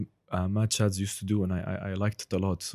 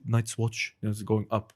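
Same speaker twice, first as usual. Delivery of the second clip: ordinary talk, nothing marked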